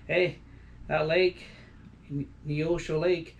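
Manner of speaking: usual speaking voice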